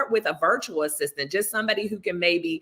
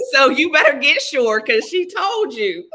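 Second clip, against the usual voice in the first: high and loud, laughing through the words; playful ribbing between giggles; laughing tease; 8 semitones higher, 8 dB louder than usual. Real, not acted. Guest teases the host about being unsure, laughing high and loud.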